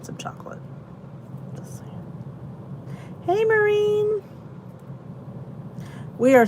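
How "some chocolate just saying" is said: whisper. Real, not acted. Aside after naming a treat.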